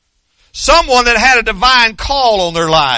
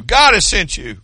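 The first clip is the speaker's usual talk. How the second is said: ordinary delivery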